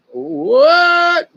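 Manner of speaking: high, loud incredulous what; can't believe it, cry out what; disbelieving what. Real, not acted. Hearing of Russians from the swamp, loud stunned disbelief.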